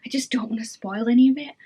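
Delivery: secretive whisper, no voice at all. Real, not acted. Whispering to viewers she won't spoil the book. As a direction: whisper it so nobody hears the spoiler. Whispered, then repeated aloud.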